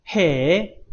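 drawn-out "heee?" dipping then swooping up; exaggerated amazed question; demonstrated surprise. Shown as a demonstration. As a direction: model an astonished rising interjection. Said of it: "它是一个很惊讶的感觉".

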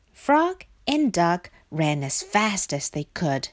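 usual speaking voice

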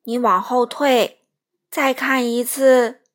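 very loud, slow booming whale. Acted. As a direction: boom out a command. Whale speaking.

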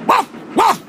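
high, light woof woof barked out; high dog bark. Acted. Grandad Dog answering the parrot with a playful bark.

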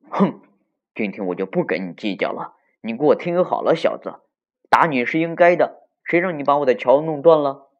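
loud, stern telling-off; cold scolding. Acted. Beaver scolding the child who broke his bridge, loud cold rebuke. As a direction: scold the kid with a loud, huffy force. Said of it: "就冷冰冰地说".

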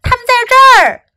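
high, loud fast shout. Acted. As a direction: yell to catch them. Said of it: "一个男人叫道".

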